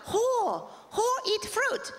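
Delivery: slow mock accent; mock Japanese accent. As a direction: coax slowly like a tiny royal. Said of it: "she keeps going".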